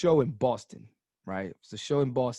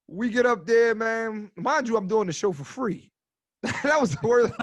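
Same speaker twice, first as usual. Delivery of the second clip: laughing; 7 semitones higher, 6 dB louder than usual. Real, not acted. Laughing at the free-show memory.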